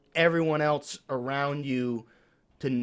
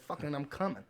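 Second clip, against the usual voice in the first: mock quiet climax; 11 dB quieter, faster than usual. Comic pretending to climax for his friend, very quiet. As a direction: keep the joke hushed and small. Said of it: "like, quiet when you come".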